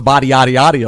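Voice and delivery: "body yaddy yaddy" with open "ah" vowels, teasing; exaggerated body pronunciation. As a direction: overdo every "ah" in "body yaddy yaddy". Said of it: "You really pronounce the".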